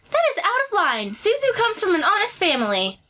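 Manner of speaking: put-on high girl voice. Voicing character, raised pitch.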